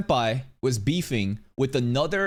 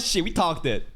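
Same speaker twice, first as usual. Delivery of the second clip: usual speaking voice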